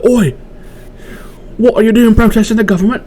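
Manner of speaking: high, badly faked Australian accent. Australian officer challenging a protester, in a badly faked Australian accent. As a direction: call out in a hammed-up Australian accent. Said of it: "I can't do an Australian accent".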